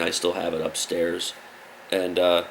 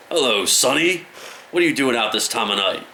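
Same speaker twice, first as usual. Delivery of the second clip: higher, louder mock cop; the cop stops the kid in the alley: mock stern, higher and louder; 4 semitones higher, 3 dB louder than usual. Loud, higher mock policeman.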